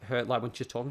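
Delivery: ordinary talk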